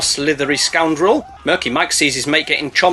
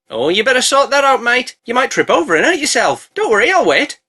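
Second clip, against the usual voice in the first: much higher, friendly snake voice; polite snake; 7 semitones higher than usual. Retold or imitated. Narrator voicing the snake to Alfred, high and polite. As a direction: play the snake oddly courteous. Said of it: "the snake rocks up and is like".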